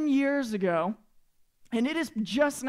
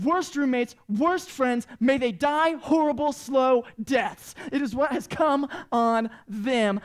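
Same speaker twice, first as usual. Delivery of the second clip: loud, indignant mock curse; mad; 3 semitones higher, 4 dB louder, slower than usual. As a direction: pronounce a melodramatic curse on them. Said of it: "I was so mad".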